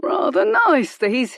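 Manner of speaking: high, loud leering thug; leering approval. Acted. Thug leering over Madeline, high and loud. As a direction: savour it like a brute. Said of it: "he approved".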